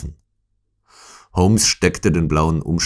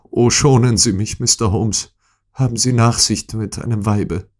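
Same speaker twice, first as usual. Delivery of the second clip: higher-pitched, urgent plea for mercy; imploring; 6 semitones higher than usual. A narrator's reading. Lady begs Holmes to spare her, imploring. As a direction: plead earnestly in a raised woman's voice. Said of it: "bat sie inständig".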